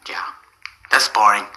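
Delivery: sighing, whispered disdain; bored dismissal. Acted. Therapist sighs at the patient's duck hobby, dismissive. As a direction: sigh and brush it off.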